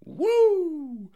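high, loud drawn-out whoop; one long high "woo!"; imitated woo. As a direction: whoop like an excited onlooker.

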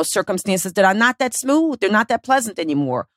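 normal talking voice

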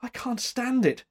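high, distressed protest; shuddering. A narrator's reading. Rescued woman begging her rescuer, shuddering. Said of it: "she shuddered".

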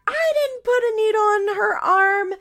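high, loud screamed denial; mock scream. Grandmother screams back at the boyfriend, high and loud. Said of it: "Barbara screams back".

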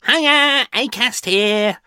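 high, loud, then slow chirpy greeting; bright ad character. Acted. Podcast ad-break character greets listeners, high and chirpy. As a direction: sing out a cheery ad-break hello. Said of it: "the character".

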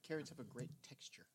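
whispering. Real, not acted. Whispered aside.

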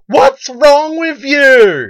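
high, loud, drawn-out yell; outraged bystander. Retold or imitated. Bystander on the bus to the pusher, outraged yell. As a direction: holler it in mock outrage. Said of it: "someone else goes".

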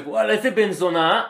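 drawn-out mock outrage; slowly milk the insult; mock indignant. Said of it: "וזה מסיים כזה".

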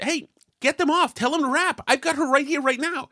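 stressed host on the phone to the producer, pleading urgency; urgent phone demand. Retold or imitated. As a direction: hurry them to wrap it up now. Frantic call to producer.

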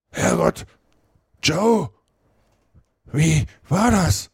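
high, slow, shaken cry of dread; a dazed drunk horrified at the body; trembling voice. Acted. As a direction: stammer it out in shock. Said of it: "sagte er mit zitternder Stimme".

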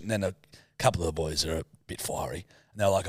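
usual speaking voice

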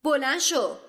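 furious snapped order; sharp order. Acted. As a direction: bark him to his feet. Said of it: "با تندی گفت".

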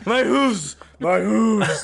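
high, wailing copycat cry; playful high-pitched lament; mock agony. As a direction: echo the hammed-up heart attack. Player joins in mock agony.

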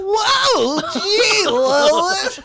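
high, loud mock Superman yell; over-the-top shout. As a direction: yell it like a hammy hero. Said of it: "he wasn't like".

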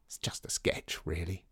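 soft modest whisper. A narrator's reading. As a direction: whisper it offhandedly. Peony modestly downplays painting.

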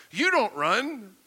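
ordinary talk

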